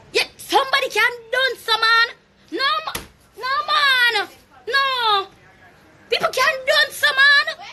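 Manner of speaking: high, crying mock whine; mock wailing. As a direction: wail it like a fake sob, way up high. Mocking someone whining on live.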